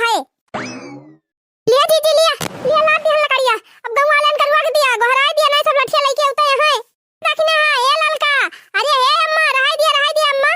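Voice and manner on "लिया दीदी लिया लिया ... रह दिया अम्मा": loud, high panicked calls across the village; calling out. Acted. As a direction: shout out in alarm as the mob comes. (ऊँची घबराई हुई पुकार)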